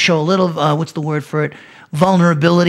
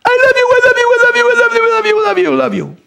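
high-pitched, nonstop gushing chant; gushing praise; 3 dB louder, much faster than usual. Acted. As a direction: pour it out in one breathless high stream. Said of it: "You know what that means?".

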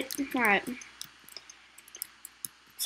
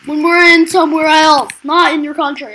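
angry rant; 2 semitones higher, 9 dB louder than usual. Retold or imitated. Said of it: "I'm like".

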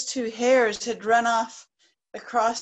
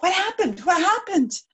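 startled cry; 9 semitones higher, 6 dB louder than usual. Acted. Startled animal voice.

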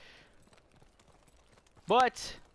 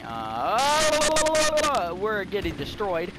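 startled yelp; 8 semitones higher, 7 dB louder, much slower than usual. Real, not acted. Losing badly mid-match.

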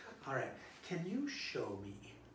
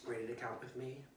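normal talking voice

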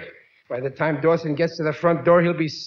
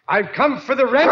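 playing Dawson; 6 semitones higher than usual. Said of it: "let's say that I'm Dawson".

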